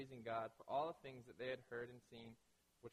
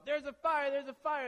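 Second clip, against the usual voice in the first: high, loud cry of fire; alarmed shout; 10 semitones higher, 11 dB louder than usual. Retold or imitated. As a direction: yell it like the room is burning. Someone running in, raising alarm.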